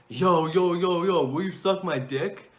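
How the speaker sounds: rude phone caller. Retold or imitated. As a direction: imitate a pushy guy calling out "yo".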